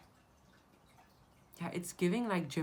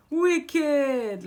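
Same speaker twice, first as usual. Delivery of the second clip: "Wicked!" shouted high in a Jamaican accent; Jamaican-style "Wicked!"; 10 semitones higher, 11 dB louder than usual. Shown as a demonstration. Showing viewers the Jamaican word, loud Jamaican "Wicked!". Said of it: "I know Jamaican".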